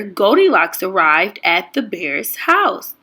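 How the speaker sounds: ordinary delivery, nothing marked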